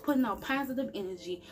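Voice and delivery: ordinary talk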